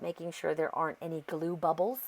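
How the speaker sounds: ordinary talk, nothing marked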